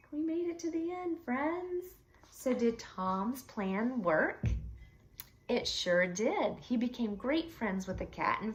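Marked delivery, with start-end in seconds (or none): none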